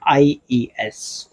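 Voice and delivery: "IES" drawn out slowly, letter by letter; slow spelling. Shown as a demonstration. Teacher slowly spelling the ending for students, unhurried. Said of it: "में क्या जुड़ेगा?".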